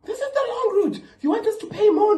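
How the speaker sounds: high laughing shriek at the driver; drunk aunt screaming. Retold or imitated. Drunk aunt shrieking at the Uber driver, high and laughing. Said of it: "Now she's screaming out of nowhere".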